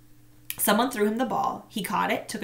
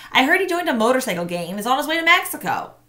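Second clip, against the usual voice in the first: put-on dismissive boy; 6 semitones higher, 6 dB louder, faster than usual. A narrator's reading. Marshall dismissive rumor.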